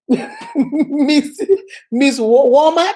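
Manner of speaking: stammering through real giggles; cracking up before the punchline lands; laughing. Real, not acted. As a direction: try to get the name out while laughing. Cracking up at her own joke.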